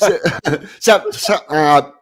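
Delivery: excited stammering restart; flustered restarts. Real, not acted. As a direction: push through the laughter to get going. Stammering restart over laughter.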